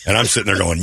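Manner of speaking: ordinary talk, nothing marked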